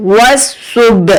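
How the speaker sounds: सुब with a small bounce on the final b; slight jolt on b. Shown as a demonstration. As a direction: give the b of सुब a little jolt, slowly. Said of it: "यहाँ पर थोड़ा सा झटका देंगे".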